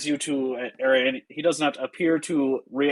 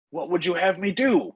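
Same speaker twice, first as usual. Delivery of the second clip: higher-pitched, pleading question; Ismark's worry; 7 semitones higher than usual. Acted. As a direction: ask it helplessly, pitched up. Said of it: "Ismark is gonna approach the front door".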